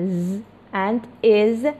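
ز and از drawn out very slowly; sounds z and iz. Shown as a demonstration. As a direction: say ز and از slowly and clearly. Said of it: "سه تا صدای س".

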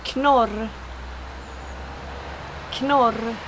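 Knorr with the K sounded; Swedish Knorr. Shown as a demonstration. Teacher showing bushcrafters the name Knorr, Swedish pronunciation. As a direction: model Knorr in Swedish, word on its own. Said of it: "words that I should, uh, pronounce".

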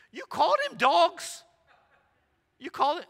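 loud, very high incredulous question; appalled disbelief. Retold or imitated. Russian pastor confronts visiting preacher, imitated shocked disbelief. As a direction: squawk it in mock outrage, rising at the end.

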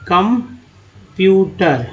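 Computer very slow, with gaps between parts; syllable by syllable. Shown as a demonstration. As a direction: pull computer apart into drawn-out syllables. Teacher splits word for learners.

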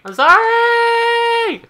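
very high, slow, loud shouted sorry; shouted apology. Retold or imitated. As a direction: scream the "I'm sorry!" out in mock panic. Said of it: "And he's gonna be like".

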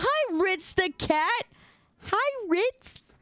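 cat voice. Real, not acted. Streamer cooing a high hello to her cat Ritz. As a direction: coo at the cat.

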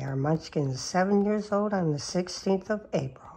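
normal talking voice

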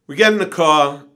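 normal talking voice

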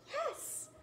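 ordinary delivery

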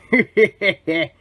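short evil chuckle; villainous cackle. Acted. Schemer watching the twerp approach, cackling. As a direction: laugh like a plotting cartoon villain.

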